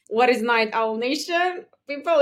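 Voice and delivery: normal talking voice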